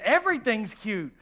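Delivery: ordinary talk, nothing marked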